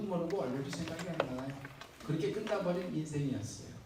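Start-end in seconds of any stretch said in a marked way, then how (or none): none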